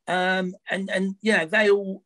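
ordinary talk

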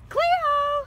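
high, sing-out call; calling the dog. Real, not acted. Calling the dog to come do something fun, high call. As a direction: call a dog over, pitched right up.

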